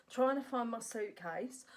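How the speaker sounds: usual speaking voice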